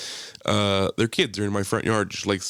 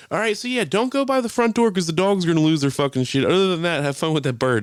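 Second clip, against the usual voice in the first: raised, higher departing warning; mock parting call; 7 semitones higher, 3 dB louder than usual. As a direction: call out instructions on your way off. Calling instructions to kids while leaving.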